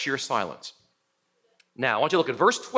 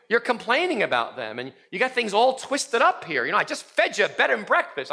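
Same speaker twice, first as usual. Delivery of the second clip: higher, scolding God talking down to Elijah; a pretend God, raised pitch, lecturing a self-pitying prophet; exasperated God; 5 semitones higher than usual. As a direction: tell him off like a fed-up father. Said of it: "if I was God, I'd slap him around".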